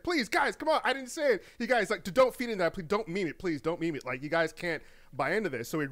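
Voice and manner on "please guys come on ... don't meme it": higher-pitched imitation of someone begging; pleading. Streamer begging his friends in chat not to meme it, pleading. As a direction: plead with the guys in a mock-desperate, raised voice. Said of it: "he was pleading with them".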